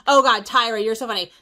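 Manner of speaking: ordinary talk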